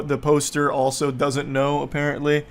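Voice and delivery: normal talking voice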